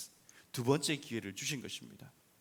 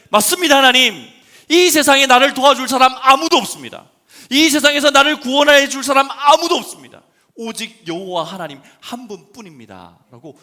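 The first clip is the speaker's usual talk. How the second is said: high, loud fervent cry of faith; loud confession; 12 semitones higher, 21 dB louder than usual. Acted. Believer confessing to God, loud fervent cry. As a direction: cry it out to God with all your heart.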